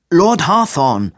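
slow, loud, high exasperated shout; losing patience. A narrator's reading. Robin, fed up, shouting at Hawthorne in loud exasperation. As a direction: snap his name out, patience gone. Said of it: "Robin riss nun wirklich der Geduldsfaden".

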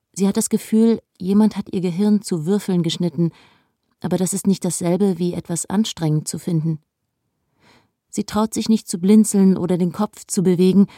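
usual speaking voice

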